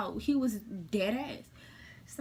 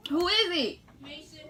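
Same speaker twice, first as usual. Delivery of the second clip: loud, higher call to the door; 7 semitones higher, 5 dB louder than usual. Real, not acted. Calling to someone off camera, loud. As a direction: call out to whoever is there.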